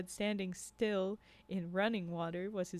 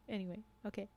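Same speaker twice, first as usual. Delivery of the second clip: ordinary delivery, nothing marked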